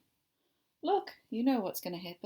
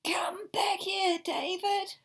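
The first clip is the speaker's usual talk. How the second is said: high, slow, loud calling after David; mother calling; 9 semitones higher, 4 dB louder, slower than usual. Acted. Mother reading the book, calling David back. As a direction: call him back sternly.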